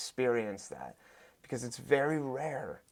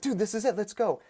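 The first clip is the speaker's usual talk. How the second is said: higher, urging him on; coach hype; 8 semitones higher, 3 dB louder than usual. Retold or imitated. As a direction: push him through the last reps. Hyping client during circuit.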